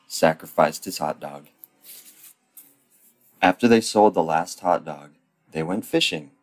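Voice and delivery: ordinary talk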